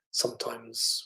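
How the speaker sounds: usual speaking voice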